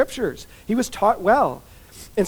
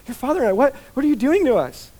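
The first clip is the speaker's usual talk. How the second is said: higher, exasperated parent voice; pitched-up "what are you doing to us"; mother's reproach; 6 semitones higher than usual. Retold or imitated. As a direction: scold like a distressed mother.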